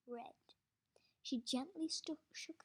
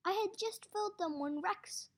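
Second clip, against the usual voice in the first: higher, louder rushed protest; protesting guard; 7 semitones higher, 6 dB louder than usual. Acted. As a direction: push back before being cut off. Podensky protesting, cut off.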